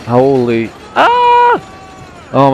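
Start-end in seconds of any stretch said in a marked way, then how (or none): 0.9-2.3 high sudden yelp on the drop; sledding downhill scream. Real, not acted. Roller coaster big drop.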